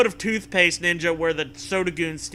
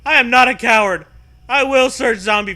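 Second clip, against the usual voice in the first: loud, high hammed-up declaration; mock heroic shout; 8 semitones higher, 13 dB louder than usual. Player roaring a boast at the zombies. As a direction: bellow it like a brave fool.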